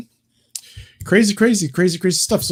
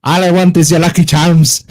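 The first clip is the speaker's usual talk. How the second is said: loud, high mock Irish accent; 6 semitones higher, 12 dB louder than usual. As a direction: belt it out in a hammed-up Irish accent. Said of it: "his fucking accent as an Irish person".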